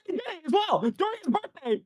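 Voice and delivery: high-pitched outburst, voice way up; worked-up yelling. Real, not acted. Co-host recounts the birthday salute, yelling high. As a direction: yell it in incredulous excitement. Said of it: "if you're gonna get this loud".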